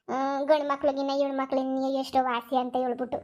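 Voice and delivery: normal talking voice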